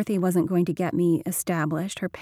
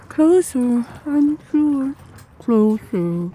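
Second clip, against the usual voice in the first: muffled, far off; 6 semitones higher, 5 dB louder, much slower than usual. Acted. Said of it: "muffled more now, farther away".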